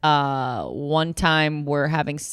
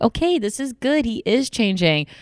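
mock hopeful self; 7 semitones higher than usual. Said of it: "I was like".

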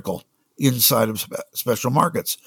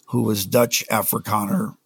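usual speaking voice

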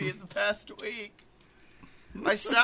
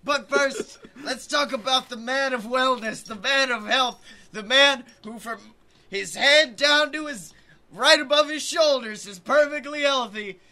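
loud, higher ring announcer call; 7 semitones higher, 6 dB louder than usual. Acted. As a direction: build up the fighter's entrance.

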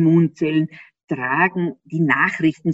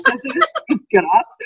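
laughing while saying it, higher and louder; 7 semitones higher, 5 dB louder than usual. Real, not acted. Guest revealing the professor is from Graz, laughing. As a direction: let the laugh carry the words.

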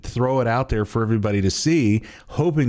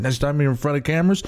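put-on high, fast mobster-style New York accent; New York wiseguy voice, pitched high; crappy New York accent; 10 semitones higher than usual. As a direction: play a shady New York fixer offering a bribe. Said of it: "a crappy New York accent".

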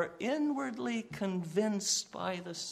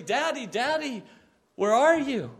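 loud, higher, frantic calling out; desperate shout; 5 semitones higher, 5 dB louder than usual. Pretend. Lost child in a department store, desperate shout. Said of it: "starts shouting out desperately".

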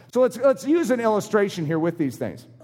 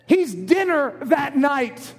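ordinary talk, nothing marked

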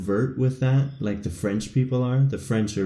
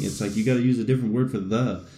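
ordinary talk

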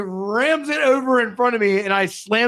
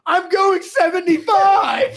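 high, slow laughing shout; indignant laughing shout; 10 semitones higher, slower than usual. Retold or imitated. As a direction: shout it while cracking up. Retold indignant driver.

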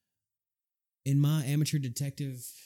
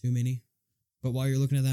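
usual speaking voice

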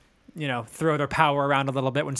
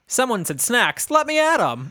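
higher, hammed-up snack enthusiasm; mock eager quote; 8 semitones higher, 3 dB louder than usual. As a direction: act raring to get at the food. Acted eager quote about snacks.

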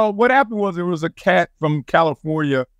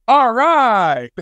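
higher, drawn-out dad impression; retold dad's exclamation, pitched up; imitating dad; 6 semitones higher, 4 dB louder than usual. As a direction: call it out like a dad of few words. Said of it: "I could hear my dad go".

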